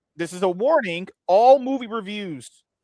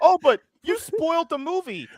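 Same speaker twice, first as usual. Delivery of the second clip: high-pitched whiny imitation of complainers; mock complaint; 11 semitones higher than usual. Streamer imitating viewers upset by spoilers, mock complaint. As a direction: mimic a viewer's protest, pitched well up. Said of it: "people say".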